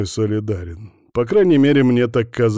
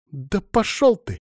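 high, drawn-out female snap; angry retort; 10 semitones higher than usual. A narrator's reading. Fallen woman to Bogdan, angry retort. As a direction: fling it back in a high voice. Alina snaps back after falling.

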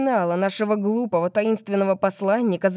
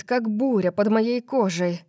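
ordinary delivery, nothing marked

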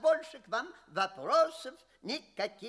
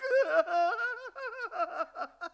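a high, mocking burst of laughter; scornful laugh; 6 semitones higher than usual. Examiner laughs off the guest's claim with scorn. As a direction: laugh the idea off.

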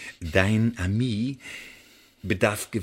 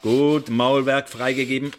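sarcastic permission; 4 semitones higher, 5 dB louder than usual. Acted. Interrogator grants permission to speak.

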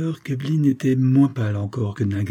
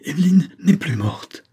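stammering; 8 semitones higher than usual. A narrator's reading. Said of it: "il balbutiait".

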